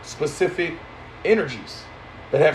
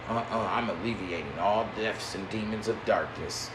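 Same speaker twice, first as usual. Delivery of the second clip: lower, much softer smug holier-than-thou voice; mocking pious voice; 8 semitones lower, 11 dB quieter than usual. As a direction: parody a self-righteous believer, hushed and prim. Mocking pious opponent's claim.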